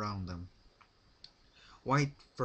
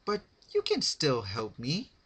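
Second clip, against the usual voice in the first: put-on high, louder childlike whine; whiny complaint; 6 semitones higher, 6 dB louder than usual. A narrator's reading. Pinkie complaining to Rarity, high whine. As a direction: whine like a spoiled kid. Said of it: "complained Pinkie Pie".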